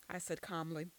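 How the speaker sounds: ordinary delivery, nothing marked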